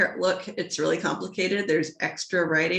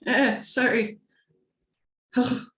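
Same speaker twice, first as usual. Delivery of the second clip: ordinary delivery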